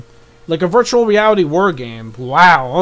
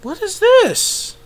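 slow question in a very high voice; high-pitched bafflement; 14 semitones higher, slower than usual. Real, not acted. Viewer puzzled by a new trailer, high squeak. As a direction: squeak it out slowly in bewilderment.

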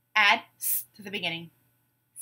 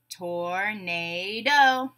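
"Tornado" very slowly, tor-na-do; three long separate beats on "Tornado"; syllable by syllable; 2 semitones lower, much slower than usual. Shown as a demonstration. Teacher breaking a word into syllables, beat by beat. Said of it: "we'll break it into syllables".

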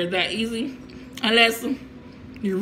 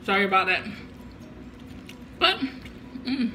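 talking with mouthful; muffled between bites; chewing; 6 dB quieter than usual. Real, not acted. Seen 1: lips mostly closed, jaw working between short words after taking coleslaw.

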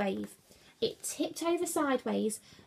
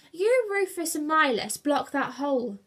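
raised, firm command; ordering; 5 semitones higher, 5 dB louder than usual. A narrator's reading. Julius directs crew to the leak, ordering. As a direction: give it as an urgent order. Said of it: "ordered Julius".

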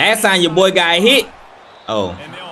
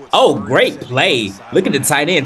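normal talking voice

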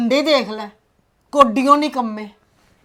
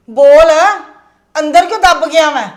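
a loud angry demand to speak up; angry shout; 3 semitones higher, 7 dB louder than usual. Acted. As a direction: bark at the son to say it. Mother demands that the son speak. (ਗੁੱਸੇ ਵਿੱਚ ਉੱਚੀ ਝਿੜਕ)

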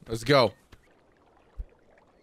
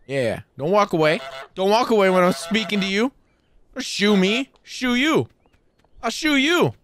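higher, louder mock indignation; mock confrontation; 10 semitones higher, 6 dB louder than usual. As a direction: play the offended tough guy. Mock confronting game character.

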